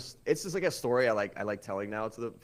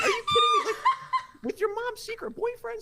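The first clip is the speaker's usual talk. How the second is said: shrieking disbelief. Retold or imitated. Confronting the girlfriend, squeaky laughing outrage. Retelling catching girlfriend cheating.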